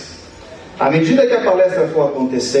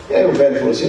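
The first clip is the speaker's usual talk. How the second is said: ordinary talk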